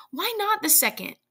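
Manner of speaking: high, pleading question; desperate, urgent appeal. A narrator's reading. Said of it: "said the old woman desperately".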